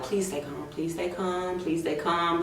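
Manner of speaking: soft self-soothing murmur, repeated; hushed mantra to calm own nerves; telling myself. Retold or imitated. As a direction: quietly talk yourself down. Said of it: "telling myself".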